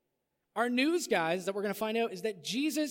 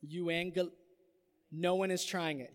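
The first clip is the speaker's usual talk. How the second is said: ordinary talk, nothing marked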